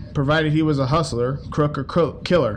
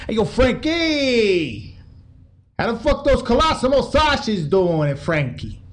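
higher, slow, hammed-up wiseguy hail; mobster voice; 7 semitones higher, slower than usual. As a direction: call out to Frankie like a swaggering mob boss. Mobster greeting Frankie Yale.

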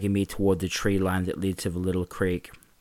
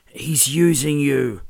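slow, higher, strained through pain; pained warning; 6 semitones higher, 5 dB louder, slower than usual. Acted. Mark warning Carl while being kicked, slow and pained. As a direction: force it out after a kick.

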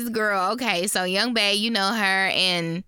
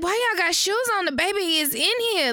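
fussy mother; 8 semitones higher, faster than usual. Retold or imitated. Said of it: "She looking like".

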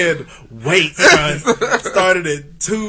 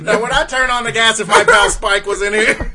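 lightly voiced old-time detective; 1930s beat cop; 6 semitones higher, 5 dB louder than usual. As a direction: thirties cop bit, laughing through it. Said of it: "Nineteen thirties cop".

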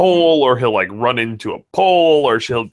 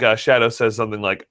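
normal talking voice

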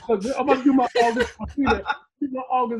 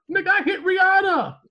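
loud, high-pitched brag; raised, incredulous boast in jest; mock boastful shout; 9 semitones higher, 5 dB louder than usual. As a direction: shout it as a comic retort. Boastful retort to mock heartbreak.

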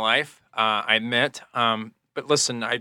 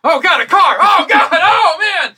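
very high, loud terrified shriek; panicked scream; 12 dB louder, slower than usual. Acted. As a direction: shriek as the car hits. Hit by a car.

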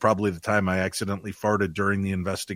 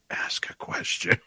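slow, voiceless whisper; imitated ghostly whisper; slower than usual. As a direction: whisper it slowly as a prompting spirit. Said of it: "the ghost is like".

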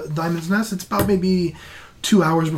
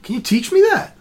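higher-pitched, wide-eyed wonder; amazed curiosity; 10 semitones higher than usual. Acted. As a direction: ask eagerly, impressed by the trick. Game master plays amazed character.

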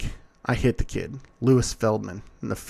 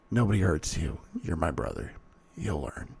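low quiet promise; 6 semitones lower, 6 dB quieter than usual. A narrator's reading. Damien reassures his brother quietly.

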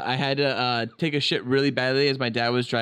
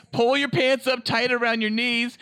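urgent dad; 8 semitones higher than usual. Retold or imitated. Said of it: "he's like".

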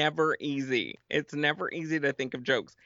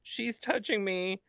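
put-on high whiny kid tattling to mom; whiny tattling little sibling; 10 semitones higher than usual. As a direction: whine like a kid in the back seat. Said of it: "one of my thighs is like".

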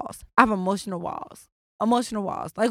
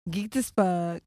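ordinary talk

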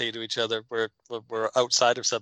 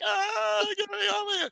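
very high squeaky cat panic; mock panicked cat. The stuck cat begging to be rescued, very high squeal.

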